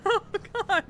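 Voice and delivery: ordinary delivery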